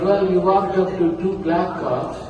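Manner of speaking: normal talking voice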